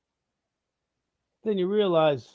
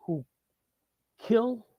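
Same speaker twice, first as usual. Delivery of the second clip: ordinary talk, nothing marked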